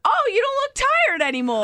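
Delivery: high, sing-song mock of his compliment. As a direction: parrot him in a high silly voice. Mocking his remark about her makeup.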